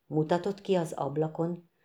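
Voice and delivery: plain reading voice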